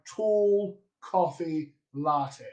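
slow British three-vowel pronunciation; British accent. Retold or imitated. Coach guessing how a UK student says it, British accent. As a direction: say it British, closer 'aw' in tall, short rounded 'o' in coffee. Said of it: "Harriet, what comes out of your mouth?".

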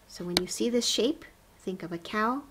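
normal talking voice

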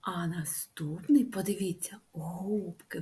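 hushed whisper; soft teacher whisper. Acted. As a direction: whisper it like a secret to kids.

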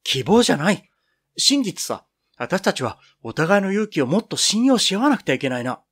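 high, loud and cutting; crushing tone. Acted. Piro-chan rebukes a friend's weak hope, crushing loud tone. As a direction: knock her argument down flat. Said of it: "やっつけるような口調で言う".

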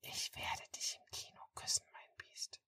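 breathy whisper right into his ear. Acted. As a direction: whisper it close, intimate and secret. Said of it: "flüstert in mein Ohr".

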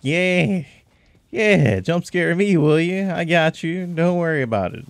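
high, loud triumphant taunt; crowing over the kill; excited gloating. Real, not acted. Gloating after killing it.

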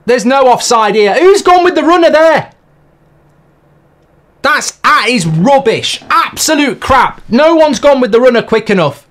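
loud angry ranting at the screen; loud furious rant. Real, not acted. Fan ranting at England's defending, loud furious rant. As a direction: tear into the defenders at full volume.

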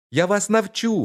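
put-on high, loud little-boy call; tiny boy calling out. Tiny boy calls out to the thieves, high and loud. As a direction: pipe up high and loud to startle them. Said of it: "гукнув мізинчик".

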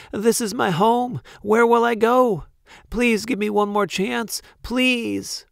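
high-pitched desperate begging; pleading. A narrator's reading. Banished baker begs the Duke, pleading. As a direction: beg urgently for mercy. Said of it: "pleaded Walter".

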